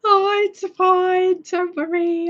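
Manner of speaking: high, slow, sing-song mock comfort; mock reassurance. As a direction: pretend to soothe, teasingly. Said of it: "I'm just kidding".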